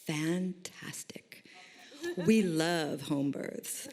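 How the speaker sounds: drawn-out whispered "fantastic", then slow, savored approval; delighted midwife. Retold or imitated. Oldest midwife welcomes a client's home birth, slow delight. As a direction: imitate her relishing every word, very slowly. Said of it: "her eyes lit up".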